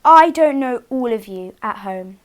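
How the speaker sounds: plain reading voice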